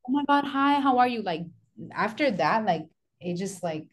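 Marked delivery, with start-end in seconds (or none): 0.1-1.2 pitched up, gushing greeting; mock overly nice. Coworker now gushing at her, pitched up and sugary. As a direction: fake sweetness. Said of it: "would be extremely nice".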